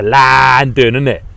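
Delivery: drawn-out mock London accent. Streamer touring UK accents for viewers, mock London accent. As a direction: stretch the vowels into a put-on London voice. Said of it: "all the different accents in the U K".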